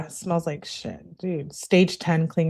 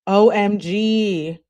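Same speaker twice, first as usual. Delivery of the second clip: "O M G" spelled out slow and louder; emphasized letters; 2 semitones higher, 5 dB louder, much slower than usual. Retold or imitated. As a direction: hit each letter of "O M G" slowly. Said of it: "She emphasizes her message".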